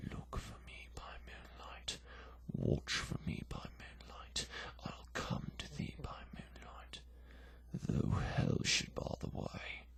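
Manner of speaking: hushed whisper. Acted. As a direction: breathe it to her in secret, barely voiced. Dead man's remembered promise.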